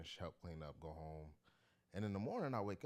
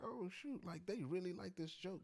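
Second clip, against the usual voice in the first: sharply higher delighted surprise; retold surprise; 13 semitones higher than usual. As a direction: yelp it out, caught off guard. Realizes fans paid for joke.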